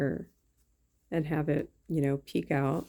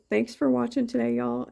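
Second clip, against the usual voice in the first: high, louder bright goodbye; cheerful sign-off; 7 semitones higher, 4 dB louder than usual. Real, not acted. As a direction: wave them off brightly. Sign-off to viewers.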